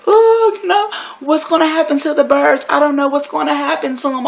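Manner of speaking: very high, hammed-up wail; exaggerated whiny sobbing voice; mock bawling. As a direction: imitate a girl crying her heart out, mockingly. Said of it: "she really, really crying. Like".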